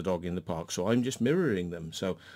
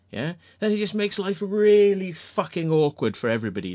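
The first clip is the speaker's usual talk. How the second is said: louder, high, fed-up; exasperated rant; 8 semitones higher, 6 dB louder than usual. Real, not acted. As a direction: hammer the point home. Ranting at the other dog owner.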